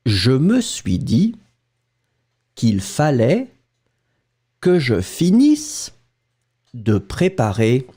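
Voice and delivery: slow phrases with long pauses, each rising until the final fall; dictation. Shown as a demonstration. Teacher giving students the third sentence to write, slow dictation. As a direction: read it out slowly, chunk by chunk. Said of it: "Écoutez et écrivez".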